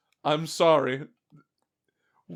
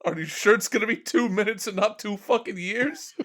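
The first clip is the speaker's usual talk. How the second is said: normal talking voice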